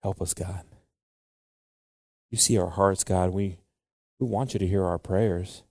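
soft, hushed prayer voice. Real, not acted. Preacher praying softly for the congregation. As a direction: pray quietly, humbly.

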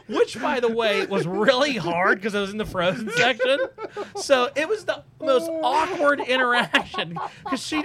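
laughing through the words; can barely hold the laugh in; high, amused, laughing as he tells it. Real, not acted. Laughing while telling awkward story.

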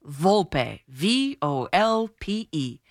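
"Volpe" very slowly as "VOL-pay", then each letter separately; teacherly spelling out. Shown as a demonstration. Teacher giving critics a first lesson, slow deliberate spelling. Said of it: "deliver this first lesson".

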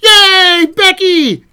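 high, loud mock cheer; cheering crowd. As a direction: squeal it like a fan.

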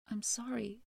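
soft, hushed, sorrowful apology; quiet apology. A narrator's reading. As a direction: barely breathe the apology. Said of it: "the boy began to weep as well".

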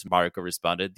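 ordinary talk

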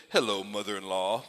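a light, low mock Newman greeting; lightly imitating Newman's smug sitcom hello; Seinfeld Newman voice. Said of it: "in my best, uh, Seinfeld Newman, uh, tone of voice".

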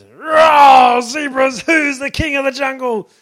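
high, loud roaring shout; a lion shouting at the zebras; lion's roar shout. Acted. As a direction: bellow it like a king of beasts.

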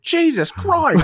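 high, drawn-out mock exclamation; hammed-up startled cry as the shark appears; Michael Caine. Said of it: "he just goes".

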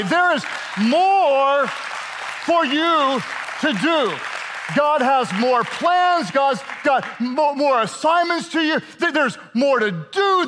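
rally the congregation; preaching at full force. Acted.

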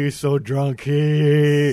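high, very loud drawn-out holler; mock drunken jeer. Mimicking a teammate mocking drunkenness.

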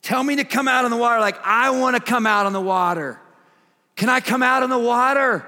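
high, loud, fast pleading Peter; an excited kid begging to be let out; eager pleading. Retold or imitated. Peter begging Jesus to come out on the water, eager and loud.

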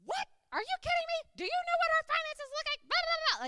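frantic high-pitched word-vomit rant; unglued outburst. Retold or imitated. Wife to husband who said quit the job, frantic mock outburst. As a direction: come unglued in a rising, high, mock freak-out. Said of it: "I came unglued".

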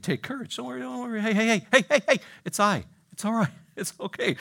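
high, hammed-up reassurance; Jesus. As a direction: call out to calm frightened friends, pitched high. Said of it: "Jesus spoke to them".

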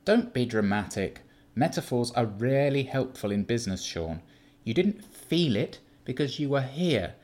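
condescending Keith. Acted. Keith lectures his shaken partner, patronising and cool. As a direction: talk down to an exasperating child.

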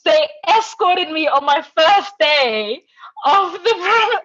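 high, loud, drawn-out disbelief; incredulous outrage. Real, not acted. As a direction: hammer out the humiliation for comic effect. Outraged climax of being thrown out.

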